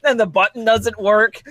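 ordinary talk, nothing marked

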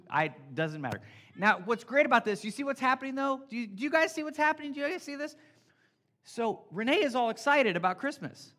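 usual speaking voice

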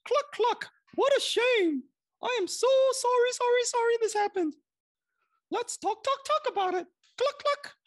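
very high clucking hen voice; chicken voice. A narrator's reading. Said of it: "The chicken was the first to notice".

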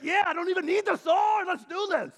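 pumped-up shout. Pretend. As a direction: fire up for the fight. Imagined disciple pumped up.

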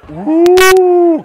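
loud high ghostly woo; spooky woo. Shown as a demonstration. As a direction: howl like a ghost. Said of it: "You go".

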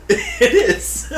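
high, loud burst through laughter; laughing. Real, not acted. Host laughing at cohost's pizza mouth.

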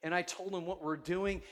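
ordinary talk

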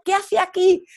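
high, loud mock scolding. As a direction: tell her off like an indignant ranger. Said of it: "echándome una bronca".

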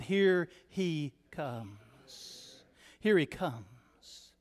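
slow, low and drawn out; hushed, weighty preacher's echo; solemn hush. Real, not acted. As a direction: let it sink to a grave, soft warning. Solemn turn after the game call.

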